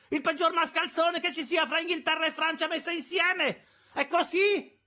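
very high-pitched, loud tirade; losing temper. A narrator's reading. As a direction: hurl insults as temper goes. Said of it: "incominciando a perdere le staffe".

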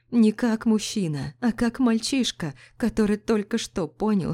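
plain reading voice

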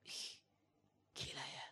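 hushed, breathless whisper; a stunned aside under the breath; awed whisper. Real, not acted. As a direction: whisper it in awe. Preacher awed by David's hardship.

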